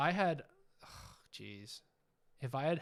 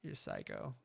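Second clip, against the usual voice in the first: dropped much lower, imitating himself; mock low voice; 7 dB quieter than usual. Telling his friend Jeremy he's a psycho, in a mock deep voice. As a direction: repeat it in a deep put-on growl. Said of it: "but I'm like".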